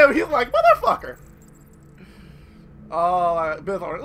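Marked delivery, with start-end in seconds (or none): 0.5-1.2 very high, loud squawked curse; mock outraged character. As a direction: voice his look as a comic high-pitched curse. Voices the glaring man's face.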